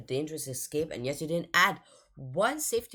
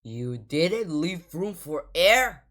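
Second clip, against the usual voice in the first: usual speaking voice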